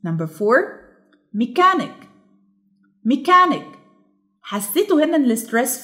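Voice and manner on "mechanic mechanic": mechanic with CHAN pitched high; stress on middle syllable. Shown as a demonstration. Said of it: "الستريس على ميكان".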